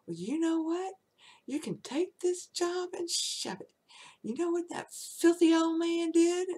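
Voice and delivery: high, loud mock yell; retold yelling. Quitting secretary yelling to the office ladies, high and loud. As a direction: broadcast it for the whole office. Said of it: "yelling it to the ladies".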